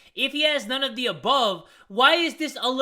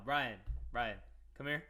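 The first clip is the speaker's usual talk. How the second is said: low, much softer, as if calling him in close; mock-confidential summons; hushed beckoning; 8 semitones lower, 15 dB quieter than usual. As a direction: drop your voice and summon him quietly. Beckons story character in close, hushed.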